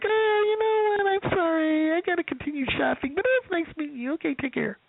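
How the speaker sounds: imitating a woman politely excusing herself to escape; phony polite. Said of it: "that kind of phony, polite".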